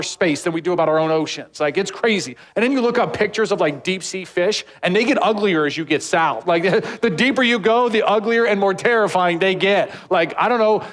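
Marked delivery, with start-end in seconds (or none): none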